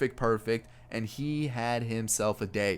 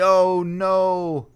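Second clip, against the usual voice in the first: loud, high groan of dismay; 6 semitones higher, 8 dB louder than usual. Real, not acted. Fan reliving Puig hitting the wall, dismayed. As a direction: wince out loud at the crash.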